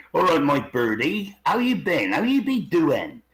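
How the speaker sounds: mimicked rural Devon dialect; Devon accent. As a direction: put on a friendly West Country Devon burr. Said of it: "both used to live in Devon".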